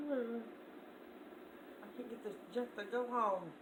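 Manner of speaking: ordinary talk, nothing marked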